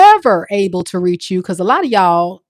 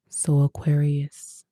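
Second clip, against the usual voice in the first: ex's voice; 3 semitones lower, 7 dB quieter, slower than usual. Acted. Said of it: "they gotta get their voice right".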